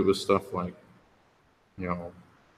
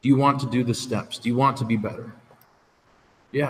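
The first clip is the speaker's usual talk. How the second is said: ordinary talk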